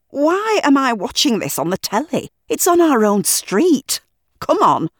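can't believe it's on our street; exasperated outburst. Acted. Brandon to Stefan, exasperated at the telly news.

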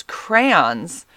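"crayons" squeezed to one syllable, crans, drawn out slowly; own pronunciation of crayons. Shown as a demonstration. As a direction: stretch "crayons" into a long "craaans". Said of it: "That's how we say it".

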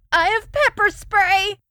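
terrified shout. Acted. Girl threatens monster.

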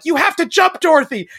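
high, loud urging; urgent plea. Retold or imitated. Blonde girl at the overflowing river, high urgent plea. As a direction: coax her to leap. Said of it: "the little blonde girl's like".

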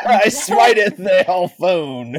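hammy wrathful deity; mock biblical prophet. Echoing mock God voice.

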